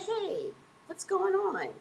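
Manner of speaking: high, bright phone greeting; greeting the sister on the payphone in a high, cheery voice; chirpy hello. Retold or imitated.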